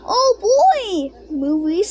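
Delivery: put-on high, eager "oh boy"; delighted kids spotting a movie; sudden childlike glee; excited cubs.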